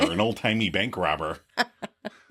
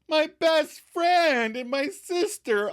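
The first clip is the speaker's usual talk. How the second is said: much higher, slow and delighted; happy disbelief; slower than usual. Retold or imitated. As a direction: savour each word with joy. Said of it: "Ross happily acknowledges".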